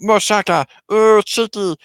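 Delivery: high, loud alien gibberish; Six Two Six. Acted. The escaping alien yells his gibberish, high and gleeful. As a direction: manic little alien babble breaking into a cackle. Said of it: "six two six runs out saying".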